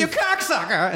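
high-pitched imitation of cursing; mock aggressive. Friend's story of her swearing at him, mock aggressive high voice. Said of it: "she got a little aggressive with him".

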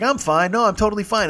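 high-pitched mock reassurance; breezy denial. Sick passenger hiding it from driver, breezy denial. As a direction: imitate a drunk insisting all is well.

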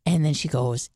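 usual speaking voice

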